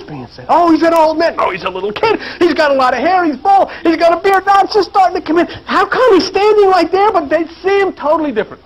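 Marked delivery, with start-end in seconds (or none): 0.5-5.5 high-pitched back-and-forth argument; hammed-up squabble, pitched way up; mock bickering. Acts out disciples' clashing descriptions. 5.7-8.6 high-pitched exclaimed question; animated preacher making the point; incredulous preaching. Real, not acted. Exclaims over the conflicting descriptions.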